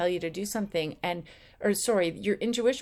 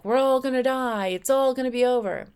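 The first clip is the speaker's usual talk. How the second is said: louder and higher, doom-laden alarm; ego in a panic; 5 semitones higher, 6 dB louder than usual. Retold or imitated. The ego driven by fear, loud panicked doom. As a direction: cry out like the end is coming. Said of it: "just driven by ego".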